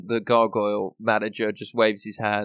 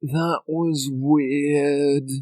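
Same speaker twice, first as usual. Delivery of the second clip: very slow deadpan; 3 semitones higher, much slower than usual. Acted. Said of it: "and says".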